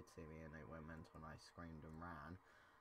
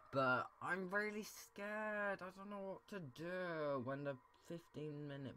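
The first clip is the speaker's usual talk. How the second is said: much higher, slow, stretched-out wail; whiny fear; 10 semitones higher, 6 dB louder, slower than usual. Real, not acted. Gamer dreading the fifteen minute mark, wailing. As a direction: whine it out dramatically.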